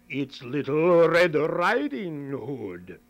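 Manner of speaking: slow, loud wolf voice; wolf poorly faking the girl; wolf pretending. Wolf at grandmother's door, slow and sly. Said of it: "the wolf said".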